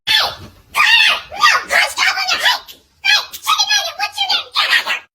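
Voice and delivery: high laughing shout; mock panicked mom yelling across the playground; frantic calling. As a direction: yell for your kid half laughing.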